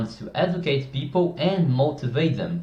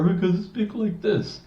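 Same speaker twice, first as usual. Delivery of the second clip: much higher put-on voice; high voice demo; 8 semitones higher than usual. Shown as a demonstration. Coach demos another annoying voice, much higher. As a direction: squeak it up high as an annoying voice example.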